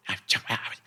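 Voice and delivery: hushed, breathy protest; whispering. Retold or imitated. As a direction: whisper it so nobody hears. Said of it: "we're all having to be hushed now".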